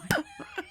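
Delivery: high, squealing burst of laughter; shrieking laugh. Real, not acted. Bursts out after the punchline.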